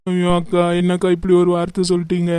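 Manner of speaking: crying. Acted. Friend begs her to stop, she protests, crying. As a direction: sob through the words. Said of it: "அழுறத நிறுத்து அறிவு".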